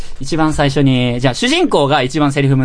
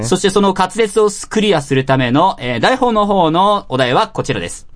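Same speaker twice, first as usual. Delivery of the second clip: ordinary talk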